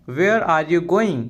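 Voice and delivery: ordinary delivery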